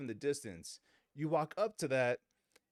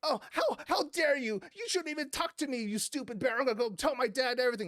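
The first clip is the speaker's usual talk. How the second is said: put-on high, bratty child's protest; huffy tattletale in a high voice; indignant kid; 9 semitones higher than usual. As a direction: imitate an offended child, pitched way up. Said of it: "this kid's just like".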